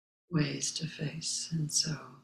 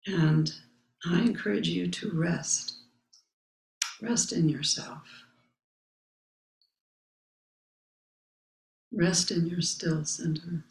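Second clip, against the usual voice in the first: calm mantra. Acted. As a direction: let each line settle like a meditation. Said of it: "my own mantra".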